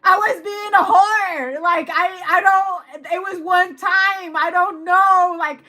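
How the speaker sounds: hammed-up panicked protest; freaking out. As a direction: plead and wail, fading as it runs out. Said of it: "someone's freaking out".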